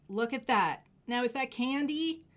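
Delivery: excited look at that after a whisper. Real, not acted. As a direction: exclaim it to the viewers. Whisper then loud repeat.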